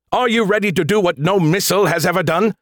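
high, building rally cry; rousing hype. A narrator's reading. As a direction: pump up the champion. Skippy hyping up the missile.

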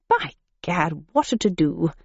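posh exclamation. A narrator's reading. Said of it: "exclaimed the gentleman".

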